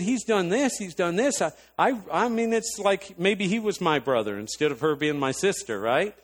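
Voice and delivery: high, worked-up arguing; retold tirade. Defending brother-in-law to sister, high indignant argument. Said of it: "a tirade with me going off on my sister".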